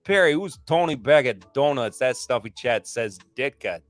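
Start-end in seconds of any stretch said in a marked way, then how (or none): none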